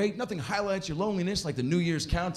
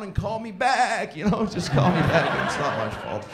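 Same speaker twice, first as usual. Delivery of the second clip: ordinary talk, nothing marked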